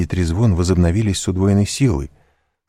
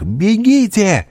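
очень высокий протяжный возглас; крикни протяжно, торопя беглеца; 4 dB louder, much slower than usual. A narrator's reading. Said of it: "крикнула мадмуазель де Армельи". (протяжный высокий крик)

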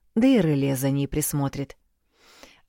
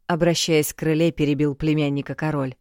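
usual speaking voice

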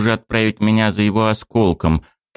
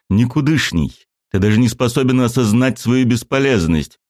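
heated insults; 6 semitones higher than usual. Acted. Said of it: "продолжала обзываться распалённый дракон".

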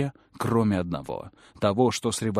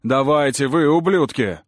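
loud, higher defiant yell; shout; 5 semitones higher, 7 dB louder than usual. Acted. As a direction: hurl the insult at them. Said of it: "закричал Чет".

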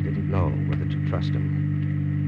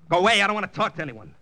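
agitated; 12 semitones higher, faster than usual. Acted. Upset man refusing visitor.

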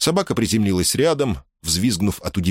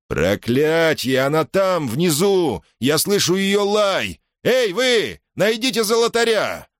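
angry king; 10 semitones higher, slower than usual. A narrator's reading. King shouting orders.

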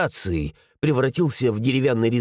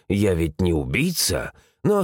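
ordinary delivery

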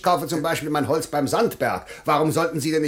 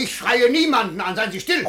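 louder and higher, snapping back at him; angry shout; 7 semitones higher, 4 dB louder than usual. Acted. Official shouts down the defiant man, angry and loud. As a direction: yell that you never yell. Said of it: "Sie schreien immer".

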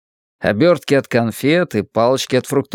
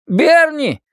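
shouting. Acted. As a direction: call out desperately to a friend. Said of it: "крикнул Кори".

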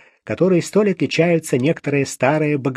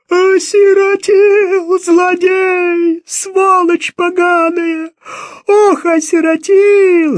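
much higher, louder, slow howl with a crying break; eerie howling wail; 6 dB louder, slower than usual. Acted. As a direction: pick up the lament and howl it. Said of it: "С жутковатым подвывом подхватила". (с жутковатым подвывом)

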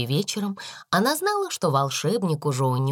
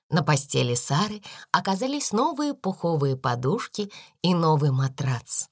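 plain reading voice

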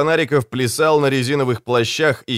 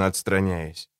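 ordinary delivery, nothing marked

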